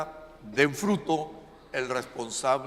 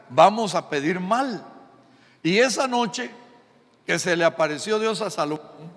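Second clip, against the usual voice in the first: ordinary delivery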